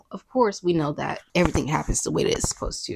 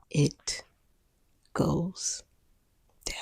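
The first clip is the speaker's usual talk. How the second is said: hushed dramatic reveal; 3 semitones lower, 9 dB quieter, much slower than usual. Acted. Dramatic reveal of wedding night.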